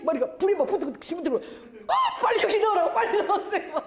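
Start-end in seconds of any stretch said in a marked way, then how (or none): 1.9-3.7 high, urgent cry through laughter; hammed-up frantic urging; mock panic. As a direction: shout as if the room is catching fire. Acts out panic as fire spreads.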